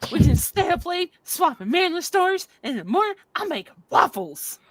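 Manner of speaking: lightly voiced cartoon Donkey; a hint of Donkey's chatty bounce; mock Donkey quote; Donkey impression. Quoting Donkey's Shrek line.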